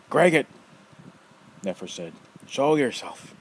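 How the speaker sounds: loud, high summons; old man summoning his creature, loud call. Acted. Nefer calls creature.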